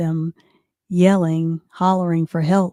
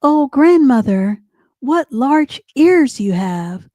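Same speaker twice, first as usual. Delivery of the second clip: put-on higher, louder little-girl wonder; slow, high-pitched storybook amazement; 6 semitones higher, 5 dB louder, slower than usual. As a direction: marvel at grandmother, stretching the words.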